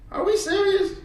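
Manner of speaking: ordinary talk, nothing marked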